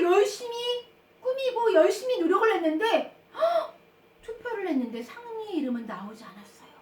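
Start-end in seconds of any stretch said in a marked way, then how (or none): 3.4-3.7 high, sudden intake of breath; shocked gasp. Acted. Storyteller reaching the disappointing vote result, shocked gasp. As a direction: gasp at unexpected bad news.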